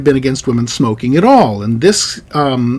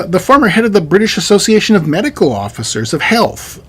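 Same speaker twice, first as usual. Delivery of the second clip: usual speaking voice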